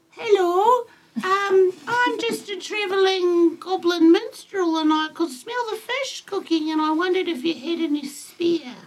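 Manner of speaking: high, eager little goblin. Acted. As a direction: play a chirpy travelling goblin. Goblin minstrel greets the party.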